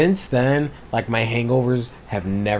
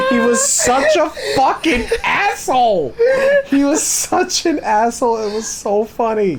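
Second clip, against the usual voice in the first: laughing while talking; 5 dB louder than usual. Real, not acted. Laughing through the line.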